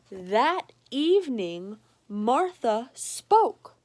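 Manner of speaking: slow and loud storybook suspense; dramatic narration. A narrator's reading. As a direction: lean in on the big moment.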